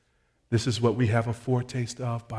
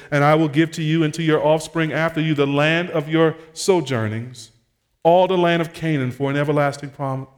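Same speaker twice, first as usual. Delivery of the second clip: raised, louder preaching voice; impassioned scripture reading; 5 semitones higher, 7 dB louder than usual. Real, not acted. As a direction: proclaim the verse higher and louder. Preacher reads scripture aloud.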